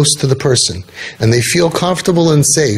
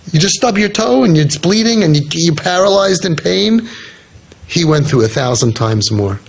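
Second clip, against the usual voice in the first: ordinary talk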